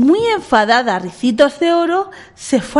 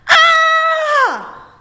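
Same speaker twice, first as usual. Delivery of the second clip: very high short frightened cry; startled scream; 4 dB louder than usual. A narrator's reading. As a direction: shriek as she wakes to bears. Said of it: "Se llevó un buen susto".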